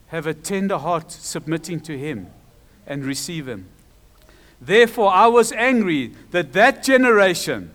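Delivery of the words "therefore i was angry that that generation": loud, forceful scripture reading; emphatic, raised preaching voice; angry proclamation. Acted. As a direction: declare God's anger from the pulpit. God's anger quoted from scripture.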